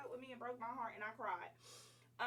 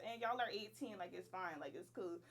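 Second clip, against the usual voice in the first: normal talking voice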